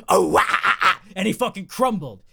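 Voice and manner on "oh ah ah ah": loud, voiced ah ah ah imitating a pop hook; mock song noise. Mocking the bald man's song with the noise, loud. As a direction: belt out the silly noise. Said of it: "mimics the song noise".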